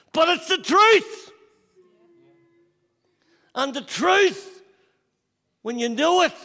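high, loud preacher's cry, slowing on key words; preaching at full force. Acted. Pastor driving home his story to the congregation, full-force preaching. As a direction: thunder the truth at the congregation.

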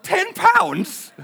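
high, loud disbelieving squeal at the price; shocked disbelief. Retold or imitated. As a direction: ten pounds, can you believe it. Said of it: "I was like".

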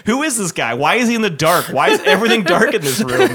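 ordinary talk, nothing marked

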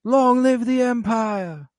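high, slow hammed-up shout from the gallery; retold yell. Heckler yelling at Mon Mothma in the Senate, a hammed-up high slow shout. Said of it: "And we hear a yell".

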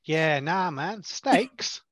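laid-back Aussie drawl; Aussie bloke brushing it off; Australian accent. Acted. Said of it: "Forgot you did an Australian accent".

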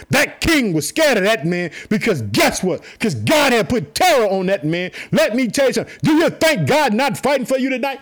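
impassioned, emphatic sermon delivery; surging, exhorting preacher's voice; fervent preaching. Real, not acted. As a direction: preach it hard to rouse the congregation. Preaches fervently on God fighting.

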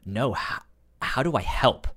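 ordinary talk, nothing marked

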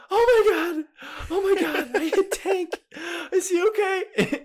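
put-on very high, laughing frantic girl voice; panicked little sister. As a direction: gasp it out, worried you hurt the dog. Sister panics over dog.

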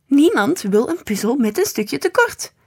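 loud, high-pitched outcry; exclaiming. A narrator's reading. Man objecting to the incomplete puzzle, exclaiming loudly. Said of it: "riep de man".

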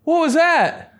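very high-pitched scolding yell; acted screechy challenge; mock confrontation. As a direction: snap it like a mad mom. Acted confronting of the phone scroller.

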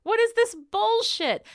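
high-pitched disbelief at the writers; outraged. Real, not acted. Outraged rant about the episode.

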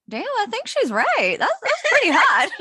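high, laughing mock awe; mock impressed. Host retelling her reaction to guest's accent, laughing. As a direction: gush like you're impressed. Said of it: "And I was like".

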